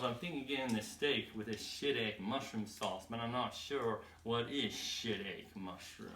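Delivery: put-on low, soft good old boy. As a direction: imitate a good old boy puzzling over the menu, quietly. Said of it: "He goes".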